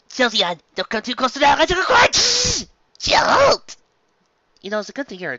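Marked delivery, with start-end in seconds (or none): 0.1-2.1 urgent warning call. Real, not acted. Warning toy near cord. 3.0-3.8 very high, loud Pokémon call; squeaky creature cry. Acted. Jolteon plush answering the host, squeaky creature cry.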